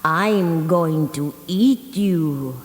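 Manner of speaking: slow, growled threat from a hungry coyote; growling coyote. Acted. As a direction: drag the words out like a predator. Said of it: "The coyote growled".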